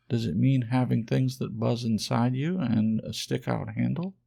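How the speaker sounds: a hint of a timid, wondering toy rabbit; rabbit's voice. Rabbit asks skin horse.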